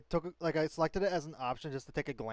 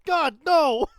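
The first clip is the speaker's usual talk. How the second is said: shouted; 12 semitones higher, 12 dB louder than usual. Real, not acted. Horrified reaction to joke.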